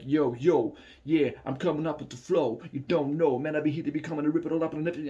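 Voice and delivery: punchy mock-American freestyle, speeding up; fake American accent. Shown as a demonstration. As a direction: put on a phony American rapper accent. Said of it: "I dropped the American accent, you know, to, to putting on a fake".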